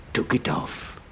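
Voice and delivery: usual speaking voice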